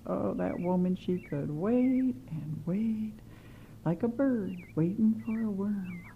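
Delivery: usual speaking voice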